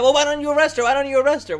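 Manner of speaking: high, loud mock demand; mocking a protester's demand; mocking leftist. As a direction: whine it like a hypocrite. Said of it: "that problem they have".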